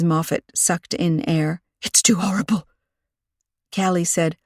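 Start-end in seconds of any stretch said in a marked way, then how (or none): 1.8-2.7 whispered, no voice at all; horrified whisper. A narrator's reading. Shaken librarian whispers her horror to Callie. Shaken woman after sucking in air.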